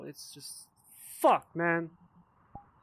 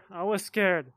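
louder, higher shout of the same words; yelled repeat; 4 semitones higher, 8 dB louder, slower than usual. Shown as a demonstration. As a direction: yell "I was scared!" with a punch on "scared".

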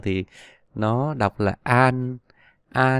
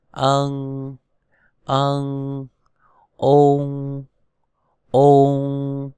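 long, level, held syllables; sustained monotone syllables with pauses between; pronunciation drill; much slower than usual. Shown as a demonstration. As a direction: chant each sound slowly for learners to repeat. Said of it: "chúng ta đọc thành hai lần nào".

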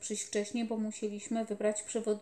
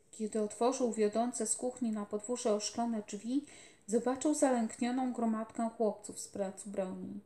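ordinary delivery

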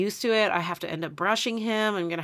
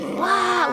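a high mock donkey bray; 12 semitones higher, 4 dB louder than usual. The narrator imitates the hungry donkey braying at her, a high mock bray. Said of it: "like that at me".

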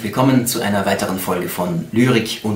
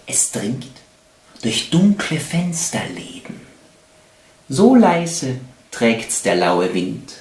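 slow, expressive poem reading with long pauses; poetry recitation; 3 semitones higher, slower than usual. Acted. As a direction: recite love poetry, swelling and falling with each phrase. Recites love poems.

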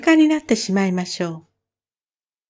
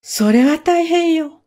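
higher, slower cry of alarm; wide-eyed alarm; 6 semitones higher, slower than usual. Acted. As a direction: frog goggling in surprise, drawn out. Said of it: "目をまん丸くして".

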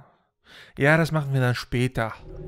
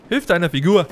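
shouting; 4 semitones higher, 9 dB louder than usual. Real, not acted. Yelling at game character.

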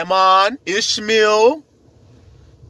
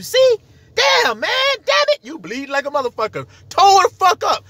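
mock taunt; 11 semitones higher than usual. Taunting the man they beat, a high mock yell.

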